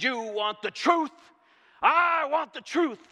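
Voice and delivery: loud, high courtroom yell; Jack Nicholson shout. Retold or imitated. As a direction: bellow it like the movie scene. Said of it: "Was that a great Jack Nicholson?".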